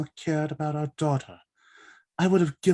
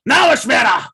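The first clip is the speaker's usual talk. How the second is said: much higher, full-force shouted order; commanding shout; 13 semitones higher, 12 dB louder than usual. Acted. Darrow shouting the order to his wife. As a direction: bellow the command across the bridge. Urgent command before ship destructs.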